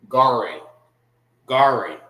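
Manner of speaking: "Garin" as GAR-in, weak short second vowel; "Garin" with front stress and a clipped-off "in" ending; English mispronunciation. Shown as a demonstration. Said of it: "how you will pronounce it in the English".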